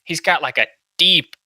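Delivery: usual speaking voice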